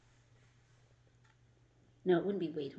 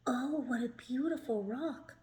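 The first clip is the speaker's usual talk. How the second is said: put-on girl's voice; 6 semitones higher than usual. A narrator's reading.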